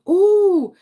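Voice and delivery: loud, high, swooping "ooh"; excited "ooh". Retold or imitated. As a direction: re-enact an impressed "ooh", big and high. Retells her reaction to the preview.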